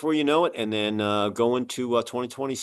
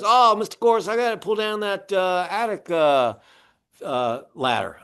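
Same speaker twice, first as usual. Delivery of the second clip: higher-pitched imitation of an old-timer inspector; good old boy; 6 semitones higher than usual. Inspector tells the homeowner about the attic ladder, good old boy imitation. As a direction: mimic a folksy veteran inspector, pitched up. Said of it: "Good old boy".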